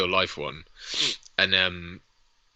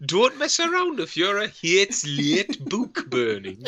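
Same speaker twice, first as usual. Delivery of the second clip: slow, raised comic Geordie; Sarah Millican; 4 semitones higher than usual. Pretend. Nazi warning about book burning, slow Geordie voice. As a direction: draw out a mock Geordie scolding. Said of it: "Nazis played by Sarah Millican".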